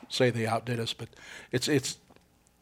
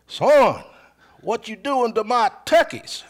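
high, loud, drawn-out challenge; imitated rancher confrontation; 10 semitones higher, 6 dB louder, much slower than usual. Cowboy-hat owner confronting a turkey hunter, mock indignant. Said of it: "Like that".

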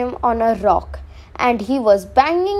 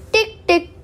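high, crisp ticks; ticking sound; 8 semitones higher than usual. A narrator's reading. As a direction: tap out a tiny hammer. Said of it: "went the leprechaun's hammer".

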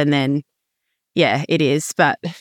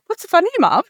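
imitating a child, much higher pitch; child's question; 12 semitones higher than usual. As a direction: play a little kid asking Mum, pitched right up. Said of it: "Alfie's looking at me like".

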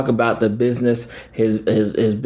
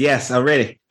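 usual speaking voice

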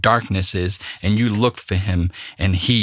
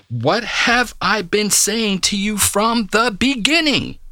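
exasperated, high and slow, drawn out; Jesus weary of repeating himself to the crowd, frustrated; 10 semitones higher, slower than usual. Acted. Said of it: "listen to the frustration in this tone".

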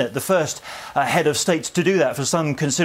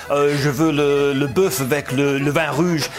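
a Brit ordering dinner in self-mocking, English-accented French. Reporter mocks his own English-accented French ordering at dinner. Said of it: "I think I'm brilliant at French".

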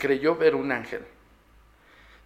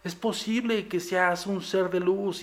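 higher, slower mock mystic voice, a bit put-on; mocking fake investigator; 6 semitones higher, slower than usual. Host mocking so-called paranormal investigators in a mock mystic voice. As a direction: ham up a gullible psychic's gentle pronouncement. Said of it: "esos güeyes sí te van a decir".

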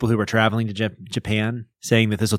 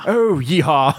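higher, mock cowboy cheer; hearty yeehaw; 5 semitones higher, 3 dB louder, slower than usual. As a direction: give a jolly put-on whoop. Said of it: "Give us a hail and hearty yeehaw".

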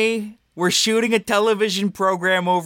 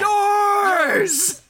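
shouting. Acted. Blaming shout in car.

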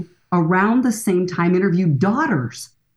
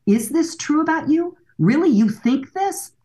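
higher-pitched, lightly acted daughter's questions; role-play a daughter mildly taken aback; lightly voiced incredulous questions; mock surprised questioning; 8 semitones higher than usual. Voicing daughter questioning her dad.

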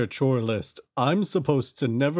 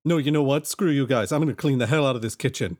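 ordinary talk

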